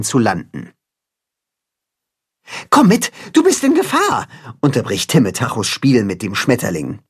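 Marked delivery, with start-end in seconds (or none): 2.7-4.3 much higher urgent little creature voice; urgent warning. Acted. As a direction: hurry the kid away from danger. Hero warns friend, high voice.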